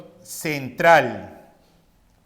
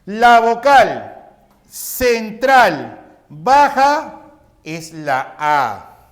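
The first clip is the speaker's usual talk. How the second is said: very slow and louder, word by word; slow, loud dictation to students; slow reading aloud; 3 semitones higher, 6 dB louder, much slower than usual. Shown as a demonstration. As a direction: read it out deliberately for the class to copy. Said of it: "Leemos así".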